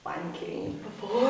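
slow words dissolving into laughter; laughing through words. Real, not acted. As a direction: giggle the last word out. Laughs while finishing sentence.

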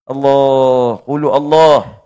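loud, ringing cry of Allah; a preacher's loud cry of Allah; fervent cry. Acted. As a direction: call out to God with full force. Fervent exclamation to God.

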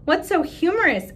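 lightly voiced music teacher asking; curious teacher. Teacher asks class.

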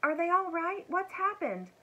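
high, urgent questions from a story character; anxious questioning. A narrator's reading. Elmer checks on the dragons from his hiding place, anxious.

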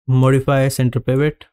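ordinary delivery

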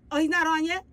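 normal talking voice

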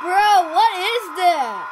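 very loud, high, drawn-out disbelief; incredulous outburst. Real, not acted. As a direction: shout it in disbelief. Reacts to something in game.

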